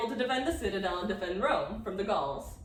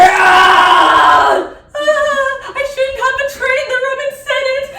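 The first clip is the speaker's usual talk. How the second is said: anguished scream; 19 dB louder, slower than usual. Acted. Catiline screaming in show.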